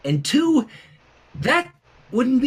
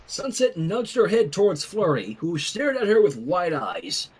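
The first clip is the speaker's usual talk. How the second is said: usual speaking voice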